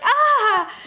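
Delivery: high, loud startled cry; startled yelp. Retold or imitated. Friend imitating a startled yelp when a bug hits the face. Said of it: "'cause you're like".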